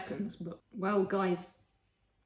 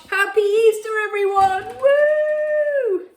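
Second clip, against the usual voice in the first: loud, high festive cheer; excited; 9 semitones higher, 6 dB louder than usual. Real, not acted. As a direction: shout it out with joy. Cheery sign-off to viewers.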